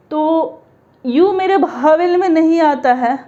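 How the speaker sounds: ordinary talk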